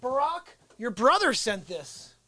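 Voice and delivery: ordinary delivery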